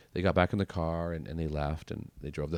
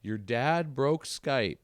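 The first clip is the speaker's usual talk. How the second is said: higher, slow mock-dramatic mom report; mom's complaint; 7 semitones higher, 4 dB louder, much slower than usual. Mom calling her son about the iPad, slow mock-dramatic complaint. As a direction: drag it out like a worried mom.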